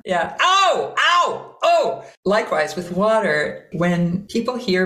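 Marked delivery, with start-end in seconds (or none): 0.4-2.0 high fiery yelps of pain, acted; hammer-on-thumb yelp. Shown as a demonstration. Teacher demonstrating fire with hurt thumb, mock pained yelps. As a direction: yelp as if the thumb got hit. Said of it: "hit your thumb with a hammer".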